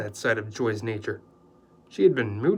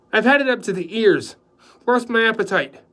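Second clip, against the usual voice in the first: fed up; 9 semitones higher, 9 dB louder than usual. Acted. Dan storms off.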